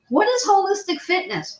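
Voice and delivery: high-pitched retold call from a car; mock shout. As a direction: imitate a stranger calling out a question. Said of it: "in his car shouted out".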